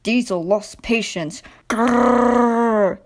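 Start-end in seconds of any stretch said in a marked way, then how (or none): 1.7-3.0 hammed-up engine growl; mock roar. A narrator's reading. Diesel losing patience with the trucks, mock growling roar. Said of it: "he roared".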